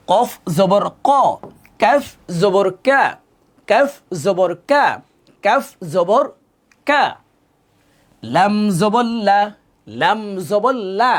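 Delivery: slow, drawn-out spelling chant; recitation drill. Shown as a demonstration. Teacher leading learners through letters, chanted drill. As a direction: stretch each letter name in a rising-falling lilt.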